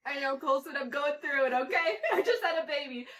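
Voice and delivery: laughing exasperation. Retold or imitated. Answering son about messy car, laughing. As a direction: laugh out the excuse. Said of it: "And I was like".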